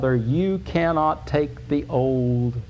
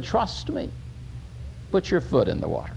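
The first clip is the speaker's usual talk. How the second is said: quiet, softer voice, gently reassuring; God reassuring softly; 8 dB quieter than usual. Acted. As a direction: speak as God calming frightened people, hushed. God speaks gently to the people.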